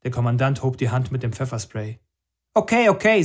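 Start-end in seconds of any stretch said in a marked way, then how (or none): 2.5-3.2 much higher, louder placating voice; boy hastily giving in to the commander; hasty giving in. Acted. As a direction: back down quickly before the spray. Boy gives in under threat.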